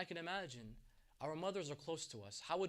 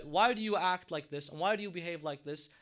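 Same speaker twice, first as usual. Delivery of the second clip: louder, challenging mock objection; arguing objector; 8 dB louder than usual. Critic challenging people over their mourning rituals, arguing loudly. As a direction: imitate an indignant critic confronting mourners. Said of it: "come and start arguing that".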